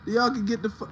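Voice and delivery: normal talking voice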